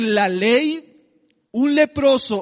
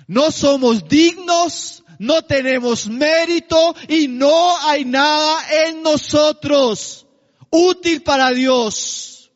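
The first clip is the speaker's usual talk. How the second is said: slow, louder, emphatic sermon climax; fervent preaching; 4 semitones higher, 4 dB louder, slower than usual. Real, not acted. Preacher to congregation, fervent and slow. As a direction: press each word home to the church.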